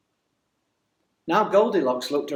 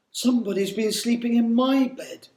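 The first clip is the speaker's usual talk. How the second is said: lightly voiced mother bear, higher than the narration; gentle middle-sized bear, pitched up a little; 8 semitones higher than usual. A narrator's reading. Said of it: "she said in her gentle".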